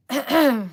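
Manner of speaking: loud forceful throat clear. Real, not acted. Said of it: "Totally losing my voice here".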